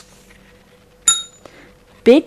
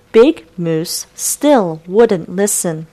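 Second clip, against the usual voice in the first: plain reading voice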